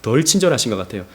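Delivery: usual speaking voice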